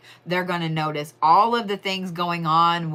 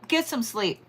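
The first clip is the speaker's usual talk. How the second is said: ordinary talk, nothing marked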